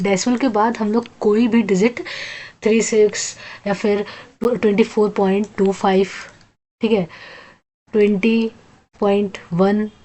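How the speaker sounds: ordinary talk, nothing marked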